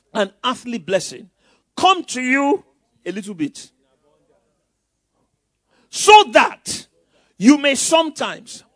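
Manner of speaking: loud, slow, drawn-out pulpit proclamation; preaching at full force. Acted. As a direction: preach each phrase with a rising fire. Preacher rereading scripture forcefully.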